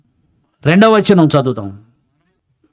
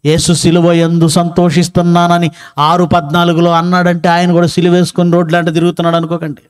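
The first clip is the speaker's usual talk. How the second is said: usual speaking voice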